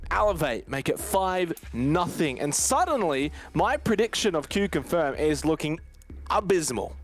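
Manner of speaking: ordinary talk, nothing marked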